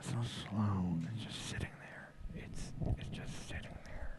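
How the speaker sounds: soft whispered announcer; whispered radio voice. Pretend. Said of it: "radio voice".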